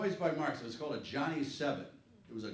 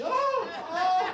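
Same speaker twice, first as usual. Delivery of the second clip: mock grandma moaning; 6 dB louder than usual. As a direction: moan like a startled grandma. Grandma reacting to pain.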